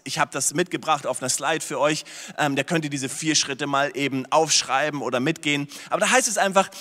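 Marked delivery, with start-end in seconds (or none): none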